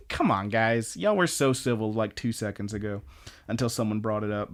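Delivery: ordinary talk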